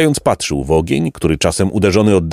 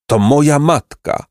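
groaned; 6 semitones higher than usual. A narrator's reading. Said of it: "jęknął zbójca".

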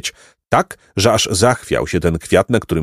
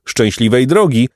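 ordinary delivery, nothing marked

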